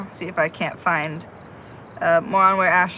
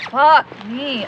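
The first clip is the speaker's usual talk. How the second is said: frustrated curse; 6 semitones higher, 6 dB louder than usual. Acted. Frustrated at the stuck lock.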